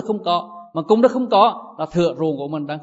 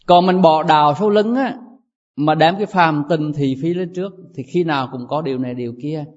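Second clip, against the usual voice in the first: ordinary talk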